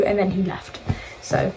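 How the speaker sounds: usual speaking voice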